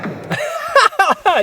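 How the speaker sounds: high-pitched burst of laughter; amused laugh. Real, not acted. Host reacting to a funny fight clip, amused laugh.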